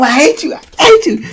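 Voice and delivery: high pitched raging repeat; rising hysteria, hitting as he goes; furious. Retold or imitated. Boo Boo hitting Charles from behind, high raging. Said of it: "Boo Boo was hitting them".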